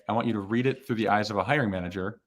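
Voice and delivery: ordinary delivery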